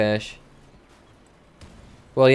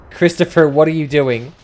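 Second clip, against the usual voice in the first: louder, higher calling out a teammate's name; exasperated call; 5 semitones higher, 6 dB louder than usual. Real, not acted. Streamer calls out teammate Christopher, louder and exasperated. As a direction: call him out, annoyed.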